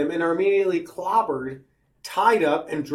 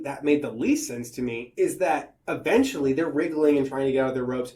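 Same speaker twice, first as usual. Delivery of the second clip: ordinary talk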